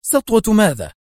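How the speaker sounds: high, exasperated question; indignant outburst. A narrator's reading. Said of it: "هتفت في سخط".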